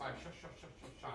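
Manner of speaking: hushed, fading to a whisper; whispered magic shoo. Acted. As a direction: breathe the spell out softly. Magician shooing over watch.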